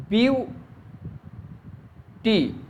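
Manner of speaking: "Beauty" split into view and टी with a long pause; syllables apart. Shown as a demonstration. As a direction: say "beauty" very slowly, one syllable at a time, view high. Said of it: "अगर अलग-अलग किया जाए".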